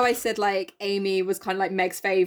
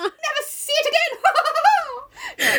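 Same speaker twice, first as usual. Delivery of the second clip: very high, shrieky villain gloat; hammed-up cartoon villain cackle; Disney villain; slower than usual. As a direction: draw out a mock evil laugh. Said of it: "Disney villain".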